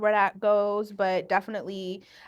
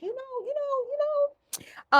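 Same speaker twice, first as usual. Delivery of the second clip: high mocking tone; slower than usual. Real, not acted.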